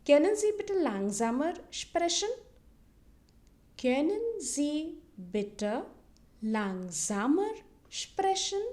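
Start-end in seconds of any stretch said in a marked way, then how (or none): 3.8-8.6 very slow German, a pause between words; slow model phrase. Shown as a demonstration. Teacher repeats the request for learners, very slowly. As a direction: stretch "Können Sie bitte langsamer sprechen" word by word. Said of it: "repeat it twice so that you can listen".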